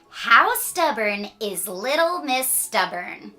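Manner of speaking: plain reading voice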